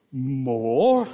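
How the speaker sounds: loud, incredulous question swooping upward; astonished. Acted. Mister Bumble answering the orphan's audacious request, astonished. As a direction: exclaim it in stern, outraged disbelief. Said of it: "he's just totally astonished".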